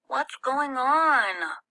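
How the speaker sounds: put-on a small kid calling from bed; little boy voice. A narrator's reading.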